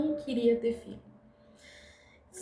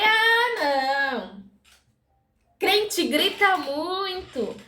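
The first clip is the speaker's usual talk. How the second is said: high, loud scoffing no; brushing off the church invite; dismissive refusal; 6 semitones higher, 3 dB louder, much slower than usual. Retold or imitated. Assistant turns down patient's invitation, scoffing high.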